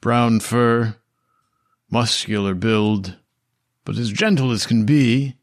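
usual speaking voice

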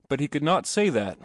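plain reading voice

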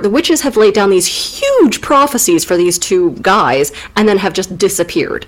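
ordinary delivery

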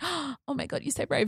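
high, breathy mock sympathy; mock gushing friend. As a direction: gush like an overly moved friend.

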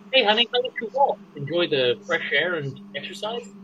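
light high voice of a welcoming wife; wife's voice. Acted. Wife greets husband home.

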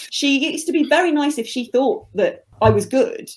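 ordinary talk

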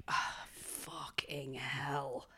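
slow, breathy whispered swearing; whispered curse. Retold or imitated. A gallery worker whispering dread as a strange man approaches. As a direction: whisper it to yourself, dragging it out. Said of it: "And I was like".

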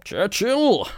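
high-pitched hammed-up echo of the name; mock-dramatic exclamation. Host echoing co-host's film introduction, mock-dramatically. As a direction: call it out high in silly grandeur.

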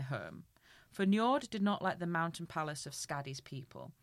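measured storybook reading; story narration. Acted. Reading a Norse myth to a friend, storybook narration. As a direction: read the myth aloud to a listener.

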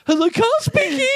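very high, silly phone voice; mocking imitation. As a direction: tease with an exaggerated phone greeting. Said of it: "everyone would give me shit and go".

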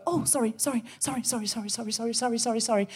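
soft, repetitive string of apologies; mock compulsive apologising. Someone always making themselves smaller, softly over-apologetic. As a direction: apologise under your breath without stopping. Mimics constant apologising while walking.

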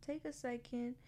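tender self-talk to a struggling self; gentle inner parent. Pretend. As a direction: soothe yourself like a loving parent.

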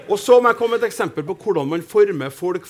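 ordinary talk, nothing marked

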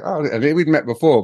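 ordinary talk

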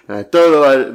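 usual speaking voice

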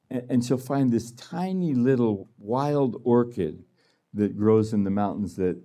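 normal talking voice

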